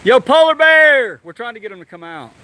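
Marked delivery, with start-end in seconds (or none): 0.0-1.2 long, high, loud call. Real, not acted. Calling the polar bear out of his cave, slow loud holler. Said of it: "trying different names".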